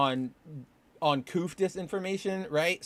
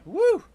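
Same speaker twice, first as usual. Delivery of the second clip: whoop; 5 dB louder than usual. Real, not acted. Calling back from across room.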